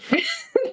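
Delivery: sudden squeaky laugh; high burst of laughter. Real, not acted. Laughs at weird dream part.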